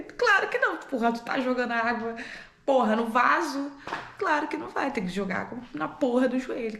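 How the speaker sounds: high, swearing outburst; exasperated mock scolding. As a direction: pretend to lose patience with the child. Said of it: "Claro que eu não falei assim".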